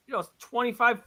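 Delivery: ordinary delivery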